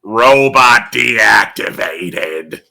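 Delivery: slow, low robot shutdown. Acted. Closing the song as a deactivating robot. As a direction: power down like a machine.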